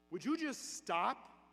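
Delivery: bursting out. Retold or imitated. Dying man interrupts the priest's praying, bursting out. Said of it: "this guy laying in bed bursts out".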